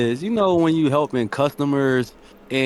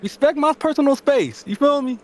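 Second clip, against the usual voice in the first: indignant; 13 semitones higher than usual. Retold or imitated. Ranting at rude coworker.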